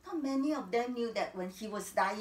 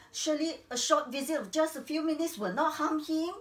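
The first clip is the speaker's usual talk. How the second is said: pleading; 5 semitones higher, 4 dB louder than usual. Acted. Wife pleads with doctor.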